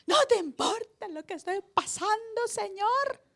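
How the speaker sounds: voz aguda y lastimera de quejoso; queja de creyente. Retold or imitated. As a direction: reclama al Señor con tono quejumbroso. Mimics complaining believers. (el pastor imita al creyente que reclama a Dios, quejumbroso)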